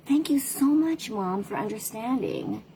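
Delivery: lightly voiced grateful daughter. Daughter's grateful reply quoted.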